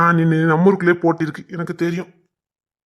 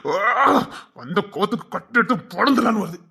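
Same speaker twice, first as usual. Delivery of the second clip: exasperated, raised-pitch venting; angry outburst; 5 semitones higher than usual. Acted. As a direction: vent as if about to lose your temper. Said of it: "என்ன கோவப்படாதனே".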